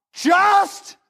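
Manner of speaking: repeating just, climbing to a shout; incredulous professor shout. Retold or imitated. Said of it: "Traina goes".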